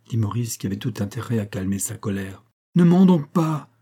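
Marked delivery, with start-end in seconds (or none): 2.8-3.7 high, loud burst of reproach; jealous outburst at a lover; angry accusation. A narrator's reading. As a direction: cut him off sharply, voice jumping up. Jealous quarrel with her lover.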